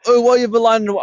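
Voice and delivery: loud, high mock complaint; imitating angry bloke. Angler retelling bloke's complaint, mock angry. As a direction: mimic an annoyed man yelling over. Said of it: "angry at me now".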